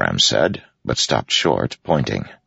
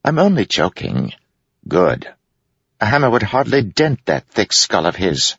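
teasing jester; 10 semitones higher than usual. Acted. Wit jokes.

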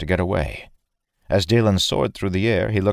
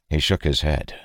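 ordinary delivery, nothing marked

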